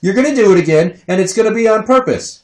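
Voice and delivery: high, loud angry shout; comic full-force parental rant; exasperated outburst. Acted. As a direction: blow up at a lying toddler. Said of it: "makes me wanna say".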